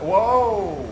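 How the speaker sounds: high, loud startled whoa; jolted by a sudden blast; startled shout. Real, not acted. Sudden shock in firefight.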